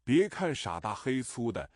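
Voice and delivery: ordinary delivery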